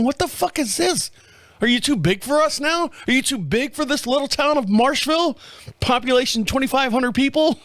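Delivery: half-joking fury at the company over the phone; angry rant. Retold or imitated.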